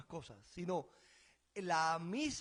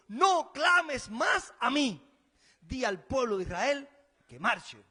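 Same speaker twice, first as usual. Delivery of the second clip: God commanding; 2 semitones higher, 9 dB louder, slower than usual. Acted. God's reply to Moses.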